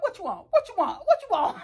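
teasing taunt. Retold or imitated. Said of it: "I'm like".

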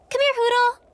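high, bright-pitched summons; calling a pet. Acted. Owner calls her owl Hootle, calling out. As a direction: call out to coax the owl over.

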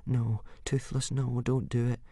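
soft, anxious warning; hushed pleading. A narrator's reading. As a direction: beg under your breath. Boy pleads with his dragon.

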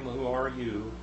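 ordinary delivery

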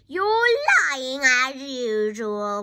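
loud, slow, accusing yell. A narrator's reading. Grandma accuses George from the roof, yelling. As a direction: stretch the accusation out loudly. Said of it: "Grandma yelled".

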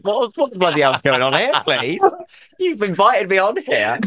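high, hammed-up indignant protest; mock outrage. Guest faking offence at host, hammed-up indignation. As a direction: play at being scandalised.

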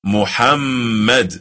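Muhammad said slowly, the doubled m held firmly; stressed correct pronunciation. Shown as a demonstration. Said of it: "Do you hear the emphasis?".

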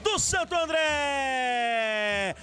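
long stretched goal shout; explosive goal commentary. Real, not acted. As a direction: hold the GOL call, drawn out and high. Goal call, long held shout.

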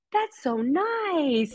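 high, drawn-out imitation of a touched son. Mother imitating her son reading kind comments, touched and drawn out. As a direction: stretch it out high, as a son moved by kindness. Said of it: "touched. He's like, he'll say".

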